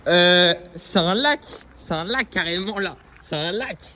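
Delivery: real astonishment at the view; amazed. Real, not acted. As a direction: let the surprise stretch the words. Discovering a lake.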